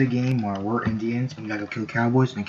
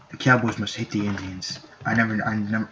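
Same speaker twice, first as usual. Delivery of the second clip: ordinary talk, nothing marked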